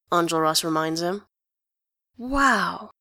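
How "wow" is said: appreciative wow. A narrator's reading. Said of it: "in an appreciative tone".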